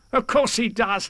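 high, bouncy tiger voice with a laugh; =free exuberant storybook tiger; Tigger. A narrator's reading. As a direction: answer high and cocksure, then laugh. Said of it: "said Tigger".

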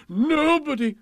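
loud, high declaration; elated king. A narrator's reading. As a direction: proclaim it grandly. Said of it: "as he slid down the banisters".

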